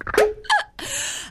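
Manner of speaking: a sharp, high breathy hiccup. Acted. Tipsy astrologer hiccups on air, high squeak. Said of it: "Oh, that hiccuping again".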